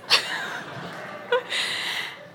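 airy laugh of delight; unvoiced chuckle of pure delight; breathy delighted laugh. Real, not acted. Grandma laughing at granddaughter.

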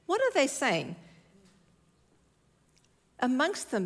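ordinary talk, nothing marked

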